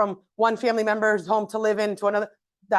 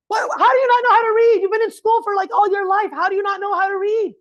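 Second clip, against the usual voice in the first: much higher and louder disbelieving yell; exasperated yell; 9 semitones higher, 5 dB louder than usual. Retold or imitated. Parent yelling at the child who can't read, exasperated. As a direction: explode at the kid in disbelief. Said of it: "you get so frustrated".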